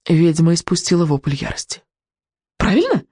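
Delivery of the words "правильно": high-pitched cry into the dark; called out. A narrator's reading. Boy checks his guess with the witch, crying out. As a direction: throw the question out, pitch leaping up. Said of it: "выкрикнул Икинг в темноту".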